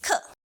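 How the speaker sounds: "Ke" high, then falling fast; sudden drop. Shown as a demonstration. Teacher showing the drop, "Ke" falling sharply. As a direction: cut "Ke" downward sharply. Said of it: "apply a sudden drop".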